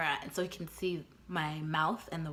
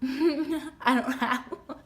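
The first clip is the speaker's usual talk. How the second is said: higher, slow words broken by laughter; laughing; 7 semitones higher, much slower than usual. Retold or imitated. Woman asked where her ring is, laughing awkwardly. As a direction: laugh through a drawn-out reply.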